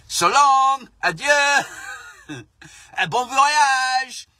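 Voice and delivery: high, loud cheery mock farewell; mock French police farewell. French police cheerily waving off the boats, hammed-up farewell. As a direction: wave them off like a jolly gendarme.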